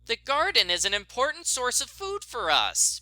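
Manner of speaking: a light stern telling-off; reprimanding. Acted. Bet scolding Nancy on the phone, mild reprimand. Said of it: "Bet reprimanded".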